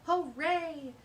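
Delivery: loud, high hooray; cheering. A narrator's reading. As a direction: cheer with joy. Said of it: "said Phil and Dennis the bugs".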